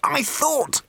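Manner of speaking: very high, strained gasp. A narrator's reading. Ford to the barman under attack, high strained gasp. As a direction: squeeze the words out high, as if in pain. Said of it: "gasped Ford".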